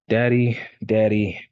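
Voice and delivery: put-on child calling daddy. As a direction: call out like a small child.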